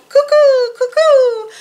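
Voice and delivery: high, loud two-note call; cuckoo call. A narrator's reading. Narrator voices the calling cuckoo, high loud birdcall. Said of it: "The cuckoo called".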